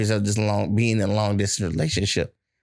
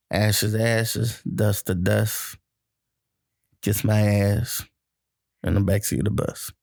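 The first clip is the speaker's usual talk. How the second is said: mock eulogy; 4 semitones lower than usual.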